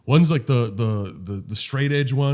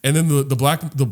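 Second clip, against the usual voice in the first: normal talking voice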